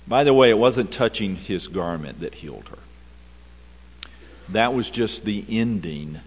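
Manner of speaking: ordinary delivery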